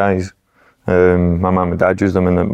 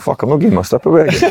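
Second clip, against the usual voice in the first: higher, exasperated mock protest; retold grumble, pitched up; retold exasperation; 8 semitones higher than usual. Protesting after being charged for strip.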